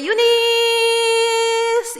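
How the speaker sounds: high, slow drawn-out call of the name; children calling. Retold or imitated. Sunday school kids call their teacher sweetly, drawn out.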